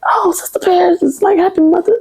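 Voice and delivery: very high, sing-song mock greeting; imitating a sweet-voiced churchgoer; sugary church greeting. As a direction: trill it up high like an eager church lady. Church member greeting her.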